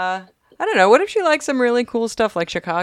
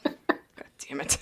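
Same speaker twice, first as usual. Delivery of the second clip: breathy whisper under breath; whispered curse; 6 semitones lower, 10 dB quieter, slower than usual. Real, not acted. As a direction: whisper it to yourself, slowly. Mutters after self-mockery.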